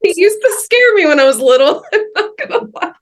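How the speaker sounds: laughing through the memory, pitch up; half-laughing confession. Real, not acted. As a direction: giggle while admitting he scared you. Laughing about scary childhood uncle.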